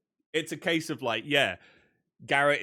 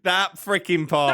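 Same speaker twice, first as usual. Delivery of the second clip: slow and louder; exasperated disbelief; 5 semitones higher, 6 dB louder, slower than usual. Real, not acted. Friend recalling the infamous movie scene, slow and loud. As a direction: drag it out in disbelief. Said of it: "reacting to the scene".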